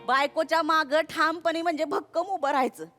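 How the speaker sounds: ordinary delivery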